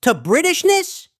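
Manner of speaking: high-pitched, appalled disbelief; incredulous outrage. Acted. Son learning his father defected to Britishness, incredulous outrage. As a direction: demand it in high disbelief, slowing at the end.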